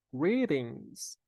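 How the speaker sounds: "Greetings" with the T as a quick R sound; tapped T. Shown as a demonstration. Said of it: "o T aqui fica com som de R". ("Greetings" com o T soando como R)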